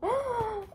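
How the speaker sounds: sharp high gasp of delight; a high, thrilled intake of breath; excited gasp. Real, not acted. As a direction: gasp at the sight of the book. Unboxing the long-awaited book.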